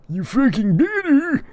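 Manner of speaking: high, loud squealed retort; hammed-up squeaky threat; Tyler. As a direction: screech it as a warning. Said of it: "His name is Tyler".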